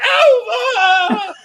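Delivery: high laughing shriek; mock disgusted shriek. Daughter walks into the bathroom, high laughing shriek. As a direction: shriek like walking into a stink. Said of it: "she'll walk in there".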